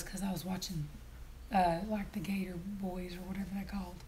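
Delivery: hushed embarrassed whisper. Real, not acted. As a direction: whisper it like a confession. Embarrassed admission of watching show.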